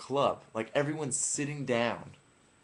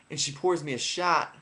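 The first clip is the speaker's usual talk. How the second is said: ordinary delivery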